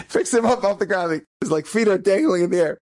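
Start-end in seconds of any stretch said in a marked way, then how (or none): none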